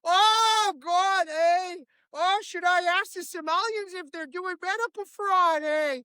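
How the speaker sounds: put-on a goofy Minnesota dad, oh boy; Tim Walz impression. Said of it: "Tim Walz is like".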